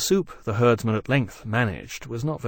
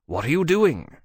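higher-pitched, baffled question; puzzled; 5 semitones higher, 4 dB louder than usual. A narrator's reading. Temeraire questioning the bristling Maximus, puzzled. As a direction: ask in bewilderment, pitch lifting.

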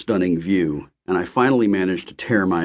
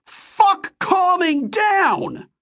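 slow, high, full-out yell; furious shout; much slower than usual. A narrator's reading. Narrator refuses Ray's plea to calm down, shouting. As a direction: stretch each word into an enraged shout. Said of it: "I shouted".

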